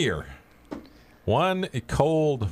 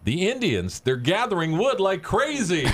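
higher, drawn-out punchline delivery; weatherman's punchline; 10 semitones higher than usual. Acted. Joke teller voices the weatherman's answer, high emphatic punchline. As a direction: land the punchline big and slow.

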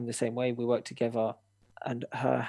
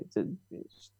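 whispering; 3 semitones lower, 7 dB quieter than usual. Real, not acted. Whispered aside.

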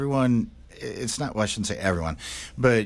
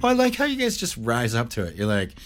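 ordinary talk, nothing marked

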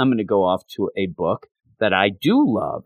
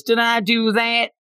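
high, put-on cartoonish voice; catchphrase imitation; 13 semitones higher than usual. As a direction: do it as a high-pitched comic catchphrase.

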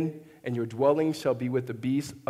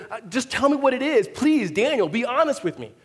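high, loud mock plea; acted royal pleading, pitched up; pleading king; 8 semitones higher, 4 dB louder than usual. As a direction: beg for the truth as a worried king. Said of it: "And the king says".

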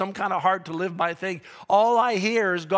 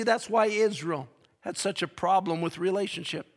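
ordinary delivery

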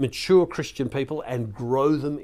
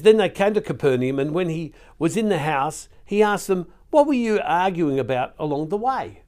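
louder, lifted Bible-reading voice; scripture reading; 4 semitones higher, 4 dB louder than usual. Acted. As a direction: read the passage out with emphatic projection. Reads the passage aloud again.

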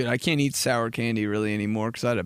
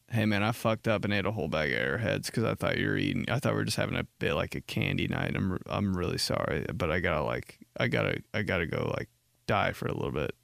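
quiet sick confession; 5 semitones lower, 5 dB quieter, faster than usual. Retold or imitated.

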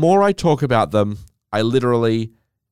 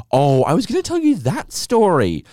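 sudden remembering; 8 semitones higher, 4 dB louder than usual. Real, not acted.

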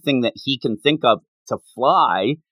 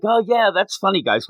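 higher-pitched fake breezy reply; pretending not hurt; 7 semitones higher than usual. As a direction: lightly brush it off, pitched up. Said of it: "tried to pretend it didn't hurt".

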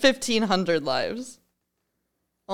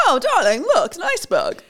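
high, loud mock-posh gasp at the iceberg; hammed-up period drama lady; posh Titanic lady; 8 semitones higher, 5 dB louder than usual. Pretend first-class wife alarms her husband, hammed-up posh.

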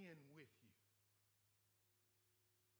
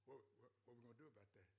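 soft voice of God; 10 semitones lower, 12 dB quieter, faster than usual. Retold or imitated. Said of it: "God said".

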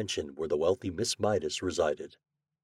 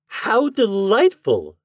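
high, loud, slow gushing delight; 12 semitones higher, 10 dB louder, much slower than usual. Acted. Spoiled heiress delighted by the glass dog, high and gushing. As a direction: gush over the idea.

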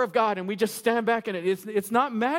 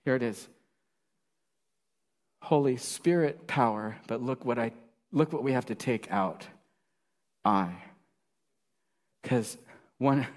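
much lower, hushed confiding preaching; intimate confession; 9 semitones lower than usual. Acted. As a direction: draw them in close, confessional.

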